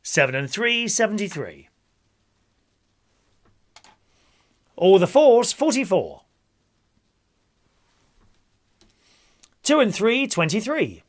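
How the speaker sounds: loud, sing-song number calling; bingo caller. Acted. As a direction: call the numbers to the hall. Calls bingo numbers to players.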